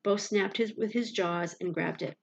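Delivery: ordinary delivery, nothing marked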